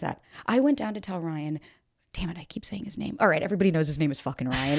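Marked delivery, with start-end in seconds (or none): 2.1-3.2 whispered, scolding herself; whispered aside. Real, not acted. Catching her own slip about Ryan, a whispered aside. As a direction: hush it to yourself like a slip. Self-correcting aside to listeners.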